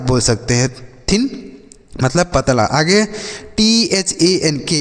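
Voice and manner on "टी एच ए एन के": put-on letter by letter, spelling टी एच ए एन के slowly for the children. Shown as a demonstration. Teacher spelling टी एच ए एन के slowly, letter by letter. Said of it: "इसे हम पढ़ते हैं".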